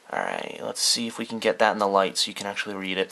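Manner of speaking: usual speaking voice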